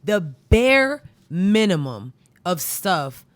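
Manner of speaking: "bare minimum" dragged out slow and loud; 1 slow heavy stress. Real, not acted. Complaining to listeners about schools, slow loud stress. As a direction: lean hard on "bare minimum", slowly.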